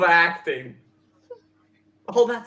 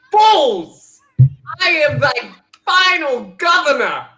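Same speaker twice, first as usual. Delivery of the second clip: louder, higher hammed-up decree; villain proclamation; 5 semitones higher, 6 dB louder than usual. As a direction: bellow it like a tyrant.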